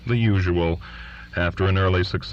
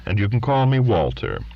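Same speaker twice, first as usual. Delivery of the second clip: ordinary delivery, nothing marked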